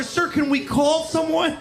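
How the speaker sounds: high, concerned mock plea; worried country girl. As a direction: plead sweetly like a naive girl. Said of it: "So she's down there".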